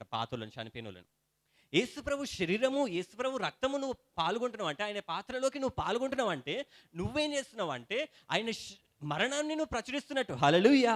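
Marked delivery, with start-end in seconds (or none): 1.7-10.3 fervent preaching. Acted. Pastor rousing the congregation about communion, fervent preaching. 10.3-10.9 a loud ringing hallelujah; shouted hallelujah. Real, not acted. Preacher shouting praise to the congregation, loud call.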